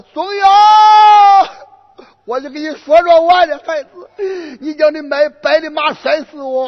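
storyteller's hammed-up wail over a loss; wailing reproach. Groom laments the dead horse.

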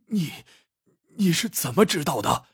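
mildly shocked, stammered start; greatly startled. A narrator's reading. Exposed minister asks the emperor, startled. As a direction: falter on the first word, then ask in alarm. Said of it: "胡惟庸大为吃惊".

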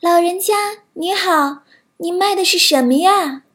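put-on friendly young princess. A narrator's reading. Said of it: "白雪公主从窗户往外看去，说道".

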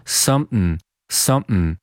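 "something" as "sum'n", throat closed mid-word; "something" reduced with a glottal stop. Shown as a demonstration. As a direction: swallow the middle of "something". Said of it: "喉音停顿来读这个单词".

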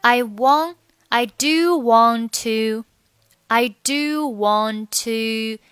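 slow "I do want to", "want" losing its t; dropped final t. Shown as a demonstration. Teacher drilling learners, "want" slowly without its t. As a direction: draw out "want" as "wan", then "to". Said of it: "末尾不发音".

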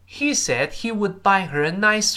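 drawn-out full-sentence demonstration; slow model reading. Shown as a demonstration. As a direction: read it out slowly for learners to repeat. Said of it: "再来一遍".

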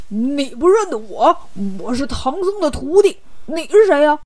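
lightly voiced oafish pig-man; put-on character voice, demanding a name; Bajie, challenging. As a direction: announce yourself with mild bluster.

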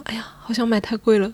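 hushed inner voice; whispered private worry; whispered thought. Retold or imitated. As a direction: whisper the regret. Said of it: "whispered thought".

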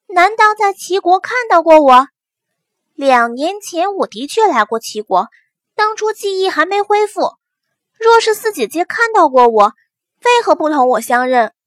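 bright puzzled younger sister; young girl voice. Acted. Young heroine Huo Shui's lines.

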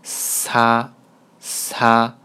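"擦" as Korean sa, opening on an airy hiss; breathy friction consonant. Shown as a demonstration. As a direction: draw out the friction at the start of "擦". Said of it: "带点摩擦音的啊". (“擦”带摩擦音，气流缓缓送出，读作사)

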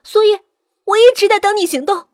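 high, urgent confession; agitated captive woman. Acted. Villain confesses her scheme while captive. (高声急切地坦白)

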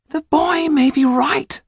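very high, slow character voice; small cautious companion. A narrator's reading. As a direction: side with the boy in a tiny high voice. Tetu voiced as a figure.

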